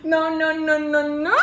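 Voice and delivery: high, loud string of cries; overjoyed disbelief. Real, not acted. Vlogger unwrapping a longed-for gift, shocked cry. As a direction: cry out in disbelief, stretching the last one.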